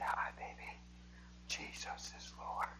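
slow soft whisper to his wife; tender whisper. Retold or imitated. Husband greeting his wife in the hospital, slow tender whisper. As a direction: whisper it slowly to someone you almost lost.

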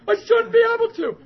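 high, loud wail of disbelief; imitated crying shout. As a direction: cry it out as the overwhelmed man. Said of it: "crying twice as hard".

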